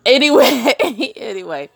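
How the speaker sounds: laughing. Real, not acted. Cutting herself off laughing.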